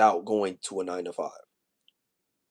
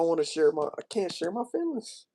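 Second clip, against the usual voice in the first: real hurt, climbing high and dropping softer; emotional; 6 semitones higher, 4 dB quieter than usual. Real, not acted. Confiding to viewers about feeling alone, emotional. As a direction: voice rising high and thin, near tears. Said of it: "I'm sorry y'all hearing me like this".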